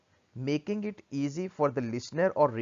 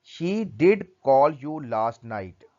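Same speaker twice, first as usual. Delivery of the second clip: did stressed, said slowly and louder; slow, louder emphasis on "did"; emphatic did; 5 dB louder, slower than usual. Shown as a demonstration. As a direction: lean hard on "did", slow and firm. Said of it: "Did is placed before the base form of the verb to stress the actions".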